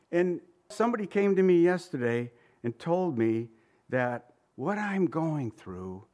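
ordinary talk

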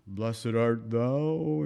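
drawn-out, slow, monotone movie Jesus; super calm monotone. Retold or imitated. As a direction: intone it very slowly, with no enthusiasm. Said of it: "super calm, you know, monotone".

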